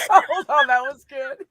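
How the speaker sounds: loud high burst of real laughter. Real, not acted. Streamer cracking up at a friend's drunk story, laughing loud. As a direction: crack up hard, then try to speak.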